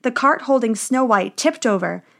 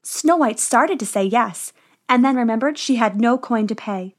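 ordinary delivery, nothing marked

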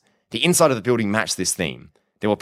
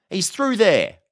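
high-pitched, obliging village policeman; beaming officer; 12 semitones higher, 3 dB louder, slower than usual. A narrator's reading. Officer relents for the polite visitor, beaming. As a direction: give in happily, pitched well up.

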